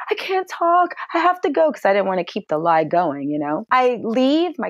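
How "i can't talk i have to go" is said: higher, tearful rush; imitating a tearful dodge; upset hurry. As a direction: brush her off through tears. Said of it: "And I'm like".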